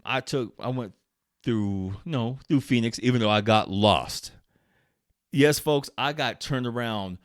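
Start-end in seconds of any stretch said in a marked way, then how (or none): none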